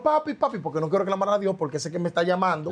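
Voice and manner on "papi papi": high, scared call to father; a child's high panicked call; calling for dad. Retold or imitated. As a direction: cry out for papi in fright. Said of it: "yo llamo a mi papá".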